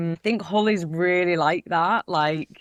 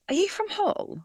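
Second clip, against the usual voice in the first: excited question. Retold or imitated. Excited recognition of Hull stranger.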